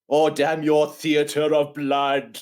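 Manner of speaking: slow, hammed-up movie lament; overwrought chaplain. Mocking the chaplain in the surgery, overwrought. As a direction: declaim it slowly, mock-dramatic. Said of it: "it's that overwrought scene".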